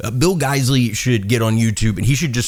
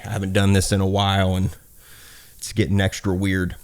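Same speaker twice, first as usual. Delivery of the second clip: ordinary delivery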